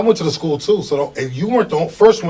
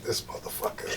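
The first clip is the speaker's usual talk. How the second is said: muttered under breath; 5 semitones lower, 16 dB quieter than usual. Retold or imitated. Retold reaction, unvoiced low.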